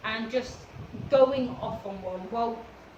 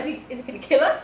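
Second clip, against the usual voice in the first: panicked quote; 3 semitones higher, 5 dB louder, much faster than usual. Retold or imitated. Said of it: "We're like".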